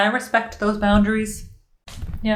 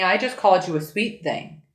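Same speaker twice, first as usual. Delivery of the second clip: ordinary delivery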